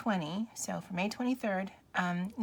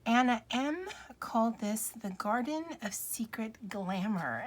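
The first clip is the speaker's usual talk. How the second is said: normal talking voice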